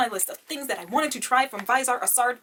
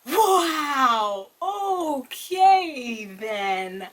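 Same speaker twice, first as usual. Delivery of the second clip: loud, very drawn-out, higher exclamation; stunned disbelief; 4 semitones higher, 5 dB louder, much slower than usual. Real, not acted. Reviewer stunned by an odd item, drawn-out disbelief. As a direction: stretch each word right out.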